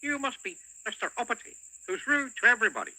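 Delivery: high, quick cheeky goblin. Acted. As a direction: needle him as a goblin. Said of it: "said the goblin".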